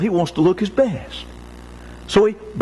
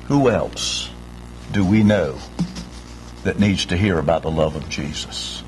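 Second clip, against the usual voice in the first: ordinary delivery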